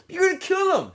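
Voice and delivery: shrieking high and loud; shout. A narrator's reading. Counselor screaming at Zero choking Zigzag, loud. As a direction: yell in alarm as one boy chokes another. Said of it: "shouted Mister Pedanski".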